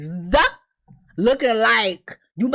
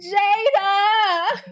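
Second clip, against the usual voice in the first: anguished call; 11 semitones higher than usual. Real, not acted. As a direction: cry out to her like she's gone. Grieving a character who died.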